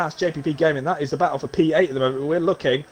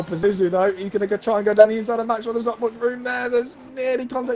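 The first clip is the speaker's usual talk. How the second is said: motorsport commentator; 6 semitones higher than usual. Real, not acted. Live race play-by-play.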